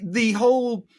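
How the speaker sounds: ordinary talk, nothing marked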